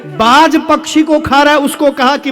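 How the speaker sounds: high, proud sermon proclamation; impassioned preaching. Acted. Preacher exalting the land of the sages and great men, impassioned.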